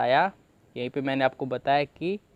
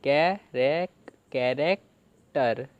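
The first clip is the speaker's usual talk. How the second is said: slow, stretched syllables; teacher slowly assembling the word; slow build-up; much slower than usual. Shown as a demonstration. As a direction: draw the word out for the class. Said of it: "तो क्या बना?".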